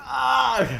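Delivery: squeaky breathless laugh; high wheezing laugh. Real, not acted. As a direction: burst into helpless high laughter. Laughing at thread text.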